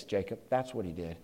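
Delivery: usual speaking voice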